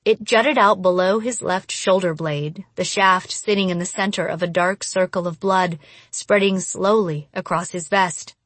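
plain reading voice

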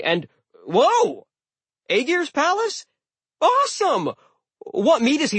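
very high, loud cartoonish sidekick; excitable talking sword. A narrator's reading. Magic sword greeting its owner mid-battle, excited chatter. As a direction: chatter breezily, leaping up on each exclamation.

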